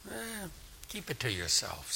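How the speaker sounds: very soft, mumbled brush-off; quiet, uninterested shrug; dismissive. Acted. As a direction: wave it away under your voice. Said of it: "and they say".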